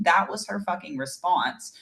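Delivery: normal talking voice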